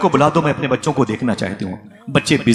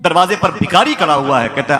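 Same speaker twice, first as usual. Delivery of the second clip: higher, louder fervent reproach; impassioned preaching; 6 semitones higher, 4 dB louder than usual. Acted. Preacher scolding the congregation over neglected neighbours, fervent and raised. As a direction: build the rebuke to a pulpit climax.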